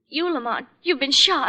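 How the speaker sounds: ordinary delivery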